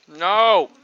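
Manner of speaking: loud annoyed yell; frustrated shout. Real, not acted. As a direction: shout at the game. B button fails.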